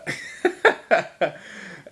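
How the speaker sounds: real chuckle after quoting; amused laugh. Real, not acted. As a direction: laugh at your own impression. Amused by own movie quote.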